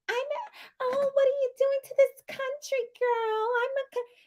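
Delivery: high, soft breathy imitation; high sugary put-on voice; mocking girly voice. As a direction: coo it like a coy country girl. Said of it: "I'm confused by the voice".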